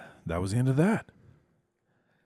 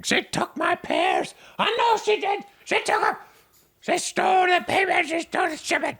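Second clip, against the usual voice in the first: very high, loud frantic wail; screaming Herb; 6 dB louder than usual. Acted. Herb screaming about his stolen pears, a very high loud wail. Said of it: "You hear him screaming".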